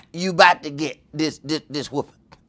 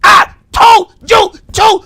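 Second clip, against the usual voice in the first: high, loud, slow crying yell; hammed-up mother's yell, much higher and louder; mom yelling mid-strike; 13 semitones higher, 14 dB louder, slower than usual. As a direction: bellow each word with the strike. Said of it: "these were accents to the strike".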